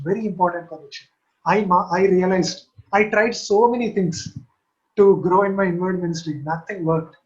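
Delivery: usual speaking voice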